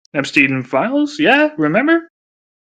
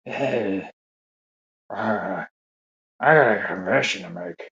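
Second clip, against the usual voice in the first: put-on low, soft whispery old-man confession; a hint of a hushed old man, much softer; Biden whisper impression; 8 semitones lower, 7 dB quieter, slower than usual. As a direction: lean in low and quiet like a creepy old politician. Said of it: "does he have that whisper?".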